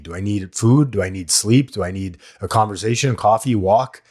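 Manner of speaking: ordinary talk, nothing marked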